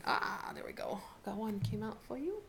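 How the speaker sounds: soft unvoiced whisper. Real, not acted. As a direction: whisper it to yourself. Card pops out during shuffle.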